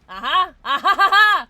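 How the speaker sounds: loud, high cackling laugh; triumphant laugh. Real, not acted. Streamer confirming her guess, laughing loudly. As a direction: crow with laughter at being right.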